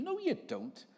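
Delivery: very high-pitched scoffing retort; mock contradiction. Answering a self-righteous boast, high mock retort. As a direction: shoot the boast down in a high squeal.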